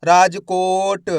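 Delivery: राजकोट slowly, with a long को; drawn-out blended word. Shown as a demonstration. Teacher showing the letters combined, राजकोट slowly drawn out. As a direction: stretch राजकोट as one joined word. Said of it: "तो मिलके बन जाएगा".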